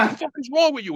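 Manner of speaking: high-pitched mock outrage; retold angry demand, not shouted; father flipping out. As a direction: imitate an exasperated dad chewing out his son. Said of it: "now my father flips out".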